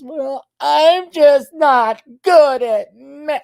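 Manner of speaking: high, drawn-out whiny excuse voice; exaggerated high-pitched helpless girl; mock girls' excuse. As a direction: whine it loud and slow, mocking. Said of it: "All the girls excuse themselves".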